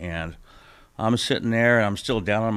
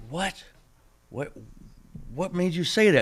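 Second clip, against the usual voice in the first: surprised; 10 semitones higher than usual. Retold or imitated. Stunned reaction to friend.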